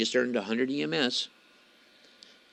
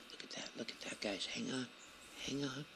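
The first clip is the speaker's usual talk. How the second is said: hushed, very soft voice; wildlife watching whisper; 3 semitones higher, 14 dB quieter than usual. Real, not acted. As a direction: keep it down so the elk don't spook. Sneaking close to elk, hushed.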